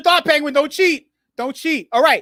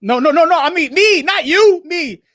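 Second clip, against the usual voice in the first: loud correction; 6 semitones higher, 5 dB louder than usual. Real, not acted. Laughing correction of confused viewer.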